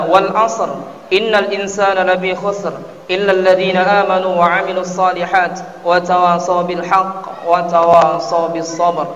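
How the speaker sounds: normal talking voice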